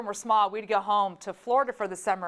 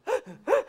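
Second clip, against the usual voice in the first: gasp; 13 semitones higher than usual. Retold or imitated. Child telling shark story.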